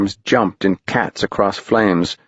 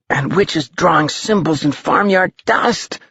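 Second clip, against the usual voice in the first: put-on high, wondering kid; a boy pointing below; higher young voice; excited boy; 8 semitones higher than usual. A narrator's reading.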